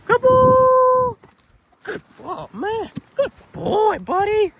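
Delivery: high, loud praise for the dog; squeaky praising a dog. Real, not acted. Praising dog after a catch.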